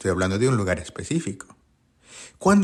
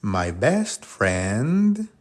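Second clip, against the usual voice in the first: very slow, each word spelled out clearly; careful classroom English; slow teacher demo; much slower than usual. Shown as a demonstration. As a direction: model it slowly for the learner. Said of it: "amigo es friend".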